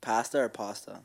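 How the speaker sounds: first "Pasta" with a as in cat, second "pasta" as PAH-sta; pronunciation contrast. Shown as a demonstration. Quizzing co-host, "Pasta" as PASS-ta then PAH-sta. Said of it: "I say pasta".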